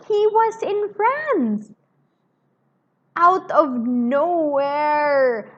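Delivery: high, loud and slow, breathless with excitement; thrilled aside. Real, not acted. Reader thrilled that Carton appears in France, excited slow gasp. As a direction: gasp and savour the surprise entrance.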